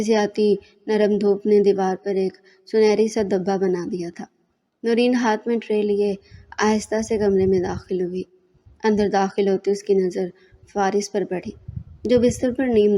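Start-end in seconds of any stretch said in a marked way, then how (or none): none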